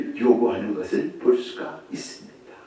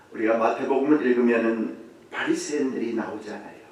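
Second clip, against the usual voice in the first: ordinary talk, nothing marked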